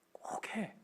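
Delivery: hushed, breathy whisper of a parent; sly little whisper; whispered urging. Retold or imitated. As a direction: whisper it like a secret nudge. Parent secretly urging child on.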